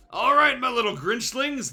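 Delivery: loud, high bellow to the Grinchlings. A narrator's reading. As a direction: bellow it out like a pompous leader. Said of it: "they bellowed".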